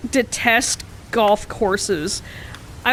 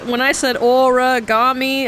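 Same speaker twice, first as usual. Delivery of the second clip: normal talking voice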